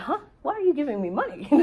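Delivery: high, fast, puzzled little question; mock bewildered disbelief; confused surprise. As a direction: replay the baffled kid being handed cash. Retelling her confusion at strangers' money.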